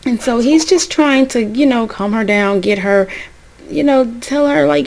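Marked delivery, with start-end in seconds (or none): none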